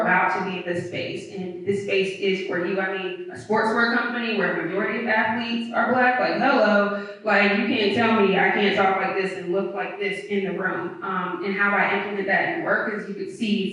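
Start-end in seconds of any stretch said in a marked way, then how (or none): none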